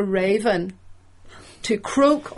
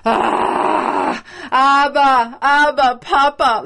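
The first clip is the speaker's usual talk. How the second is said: put-on louder, higher child's plea "Abba, Papa"; child crying out; 4 semitones higher, 4 dB louder, much slower than usual. Preacher voicing the Spirit as a child calling its father. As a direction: call out like a child wanting its daddy. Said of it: "the Spirit in us is going".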